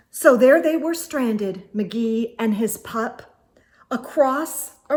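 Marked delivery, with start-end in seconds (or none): none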